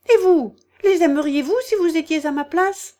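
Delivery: the offended mouse throwing it back; indignant mouse. A narrator's reading. Mouse scolds Alice. (souris indignée, plus aiguë et plus forte)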